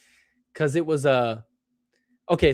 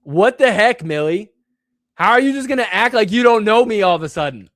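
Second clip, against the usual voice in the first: indignant; 6 semitones higher, 6 dB louder than usual. Real, not acted. Indignant at being ignored.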